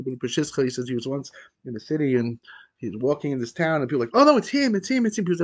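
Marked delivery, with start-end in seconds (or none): 4.1-5.2 high-pitched cry of recognition, lightly acted; alarmed townspeople. Townspeople spotting the man in the street, mock alarm. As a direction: voice a startled crowd, pitched up.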